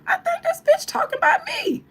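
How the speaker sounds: high, loud indignant squawk. Retold or imitated. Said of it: "[name] was like".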